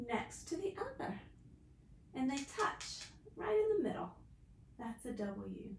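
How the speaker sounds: normal talking voice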